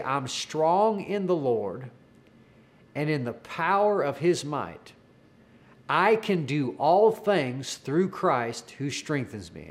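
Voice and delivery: slow, measured declaration with long pauses; retold slow prompt. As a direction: lead someone phrase by phrase, leaving room to repeat. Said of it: "take breaks in between words if you need to".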